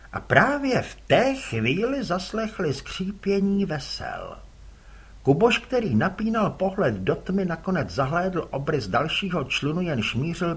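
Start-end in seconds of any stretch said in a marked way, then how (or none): none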